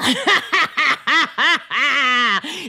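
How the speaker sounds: high, loud rising witch cackle; the witch's full triumphant cackle; evil victory cackle. Acted.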